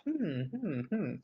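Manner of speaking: soft, pondering hmm; short quiet hums of a puppet thinking. Shown as a demonstration. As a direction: hum softly as if pondering. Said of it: "I'm thinking, I start looking up".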